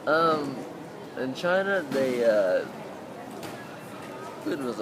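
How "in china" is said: ordinary talk, nothing marked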